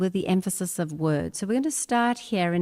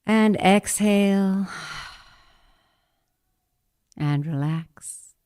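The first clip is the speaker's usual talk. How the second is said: slow, drawn-out breath cue; guided breathing; 3 dB louder, slower than usual. Acted. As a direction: release the breath slowly.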